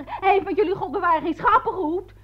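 high-pitched scolding retort; retold indignant shout. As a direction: re-enact telling him off. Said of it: "Ik schreeuwde tegen hem".

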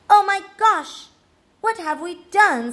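high, dismayed cry; exasperated outburst. Acted. Luke covers his ears from questions, exasperated. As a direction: exclaim in overwhelmed dismay.

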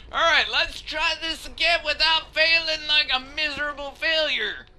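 high-pitched, strained rallying call; frustrated self-mockery. Real, not acted. Player retries after failing miserably, exasperated.

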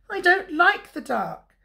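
high, plaintive cub voice; Little Bear. A narrator's reading. Little Bear explains the fear to Big Bear, high plaintive cub voice. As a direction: complain high like a small scared cub. Frightened cub complains at bedtime.

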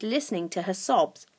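ordinary delivery, nothing marked

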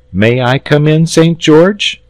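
put-on high, polite boy; politely asking boy. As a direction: ask courteously at the door. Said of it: "said the boy politely".

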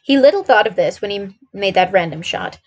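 plain reading voice

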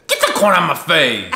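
loud silly yell; shouting. Retold or imitated. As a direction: belt it out like a catchphrase. Silly quoted catchphrase, shouted.

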